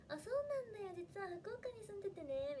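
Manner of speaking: high, lilting acted reply; playful showing-off. Schoolgirl coyly tells classmates she lived in Fukuoka, showing off. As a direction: let slip a secret, pitched well up. Acting out her deliberate breezy reply.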